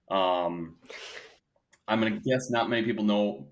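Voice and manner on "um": normal talking voice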